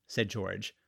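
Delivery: ordinary delivery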